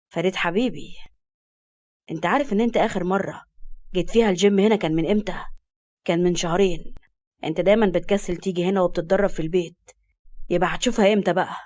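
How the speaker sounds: low male voice teasing a friend. Acted.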